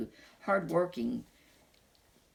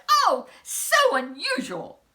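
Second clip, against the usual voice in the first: high, loud, slow wonder; marvelling; 9 dB louder than usual. Acted. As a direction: savour the surprise like a thrilled king. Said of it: "he cried".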